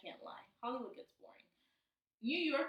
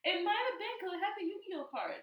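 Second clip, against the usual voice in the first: higher, giggly defense; laughing; 7 semitones higher than usual. Real, not acted. Host defending her cartoon guess to co-host, laughing. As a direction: talk through your giggles.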